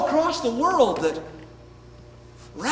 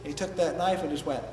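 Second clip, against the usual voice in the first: ordinary delivery, nothing marked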